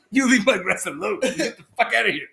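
high, mock-angry scolding; grumpy old man. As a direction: chase them off like a cranky old man. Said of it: "Half Sack as an old grumpy".

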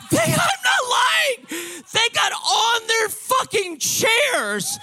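high, loud, drawn-out yell; excited shouting. Real, not acted. Storyteller swearing it really happened, high excited shout. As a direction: shout it in amazed insistence.

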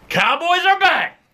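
high, hyped team cheer; excited cheer. Real, not acted. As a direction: cheer it like a fan.

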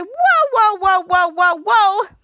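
loud, high string of whoas; urgent halting shout. Retold or imitated. Mika halts the squabbling team, urgent halting shout. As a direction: burst in high, then keep hammering loudly.

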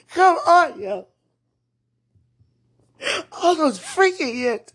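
sobbing wail, high and loud; crying shout. Real, not acted. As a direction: wail it in raw grief. Crying outburst over lost years.

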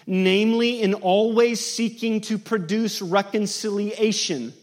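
loud, high sermon exhortation; fervent appeal. Acted. Preacher urges the congregation to seek reconciliation, loudly. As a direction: exhort them with full force.

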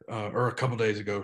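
usual speaking voice

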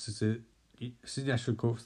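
ordinary delivery, nothing marked

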